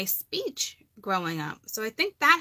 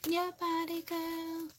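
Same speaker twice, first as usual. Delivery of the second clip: higher, hushed mock come-on; soft mock seductive catcall; sultry fella; 8 semitones higher, 7 dB quieter, slower than usual. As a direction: purr it like a flirting guy, teasing. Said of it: "Makes the fellas go".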